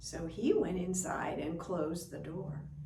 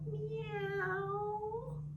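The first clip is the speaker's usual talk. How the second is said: high, soft rising meow; a timid kitten looking up; 13 semitones higher, 4 dB quieter than usual. Acted. Kitten greets old man.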